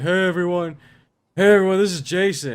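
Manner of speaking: loud, high, sloppy party shout through the door; a hammed-up drunk college roommate calling out; drunk roommate greeting. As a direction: bellow it like a drunk roommate knocking.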